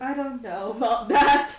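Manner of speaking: loud laughing reply. Real, not acted. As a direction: laugh through the words.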